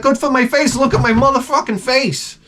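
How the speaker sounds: high, incredulous indignant retort; mock outrage. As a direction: throw her words back at her in disbelief.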